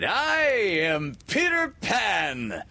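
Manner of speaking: slow, high, triumphant announcement; proud cry. A narrator's reading. Peter reveals himself to the pirates, crying out proudly. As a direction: proclaim it slowly in a high ringing cry. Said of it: "he cried".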